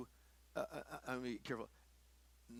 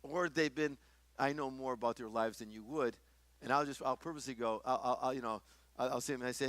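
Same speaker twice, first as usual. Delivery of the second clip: ordinary talk, nothing marked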